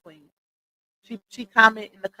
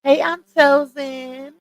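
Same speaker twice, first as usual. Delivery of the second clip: high, slow put-on voice; slow, higher-pitched imitation; Footbeat; 5 semitones higher, slower than usual. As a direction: draw out each word in a raised mimicking voice. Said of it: "in my Footbeat voice".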